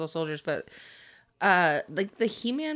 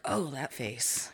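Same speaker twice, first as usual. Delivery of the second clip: hushed dismay; 5 semitones lower, 12 dB quieter, slower than usual. Retold or imitated. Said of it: "you're like".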